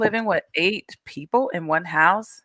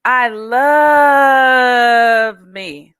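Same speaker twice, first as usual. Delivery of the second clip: demonstrated cheer; 4 semitones higher, 7 dB louder, much slower than usual. Shown as a demonstration. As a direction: show the kids how to say it big. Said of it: "You're gonna go like this".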